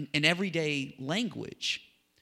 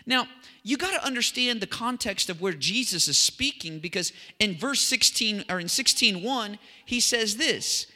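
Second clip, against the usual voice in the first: raised, higher preaching voice; fervent preaching; 8 semitones higher, 5 dB louder than usual. Real, not acted. Preacher explains what Jesus said to his followers, fervent preaching. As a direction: drive the teaching home like a preacher.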